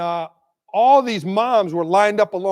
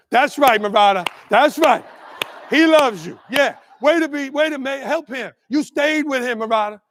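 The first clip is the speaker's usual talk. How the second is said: raised, louder calls of affirmation; retold yelling; 5 semitones higher, 5 dB louder than usual. Moms along the fence to the teacher, retold yelling. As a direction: imitate a line of moms calling out in support. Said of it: "moms that were yelling at me".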